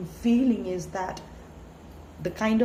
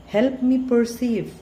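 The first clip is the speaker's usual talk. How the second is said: fervent plea; 3 semitones higher, 7 dB louder than usual. Real, not acted. Prayer-like plea at the end.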